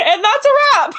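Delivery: high, laughing "that's a wrap" sign-off; announcer exclamation. Pretend. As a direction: proclaim "that's a wrap" like a host. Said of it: "No, I'm serious".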